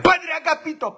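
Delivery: high, loud falsetto maid calling the priest; high-pitched mock maid voice; maid's shrill call. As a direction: squeal his name like a fussy old maid. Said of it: "Doña Chencha llegaba con el padre Agapito y le decía".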